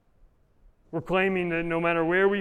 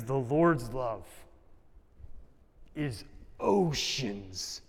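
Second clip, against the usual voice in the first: hushed awe; 4 dB quieter, much slower than usual. Acted. Sermon climax, slow and hushed.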